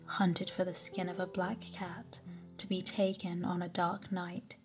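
ordinary delivery, nothing marked

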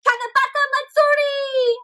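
high, excited shout of the festival name; joyful scream. A narrator's reading. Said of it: "Keiko and Oba scream together".

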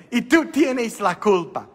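put-on high, slow American-accented Spanish of the nun; the American nun in her broken Spanish, high and laughing; nun's American accent.

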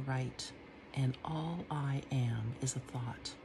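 musing, drifting poem read with soft whispered lines; dreamy reading that sinks to a whisper at times; poetry reading. Acted. Reading her second poem to the camera, musing and hushed. Reads own poem aloud.

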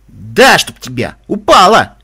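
loud, sharp snap at her; sharp retort. A narrator's reading. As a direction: bark it out sharply. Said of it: "резко ответил Каламити".

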